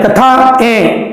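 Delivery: कथाएं said very slowly with the final ए nasalised; Hindi: कथाएं धीरे से, ए को नाक से; slow nasal demo. Shown as a demonstration. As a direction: stretch कथाएं and let the ए ring through the nose. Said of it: "इस ए को ही नाक के सहयोग से बोलना है".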